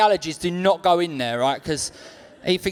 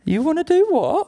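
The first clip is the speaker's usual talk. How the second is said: put-on shocked girlfriend; 10 semitones higher than usual. Mock girlfriend reply.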